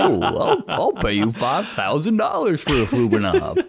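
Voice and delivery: high, loud, hammed-up bidder; mock eager buyer. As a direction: play at excitedly outbidding the crowd through laughter. Plays eager fairground buyer.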